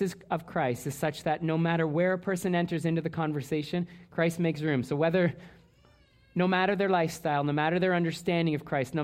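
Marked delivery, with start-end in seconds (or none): none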